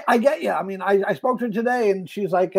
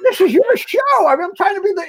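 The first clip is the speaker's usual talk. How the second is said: laughing protest; 10 semitones higher than usual. Retold or imitated. Defending himself, laughing.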